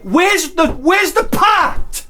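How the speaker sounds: high, loud and very slow; loud drawn-out question. Retold or imitated. Imitating a visitor to his basement.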